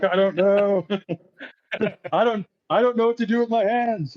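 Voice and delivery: high-pitched, laughing whine of confusion; mock helpless panic. Scared private answers his superiors, mock helpless panic. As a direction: plead helplessly, voice way up. Retold helpless reply to scolding.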